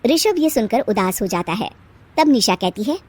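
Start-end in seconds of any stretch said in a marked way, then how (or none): none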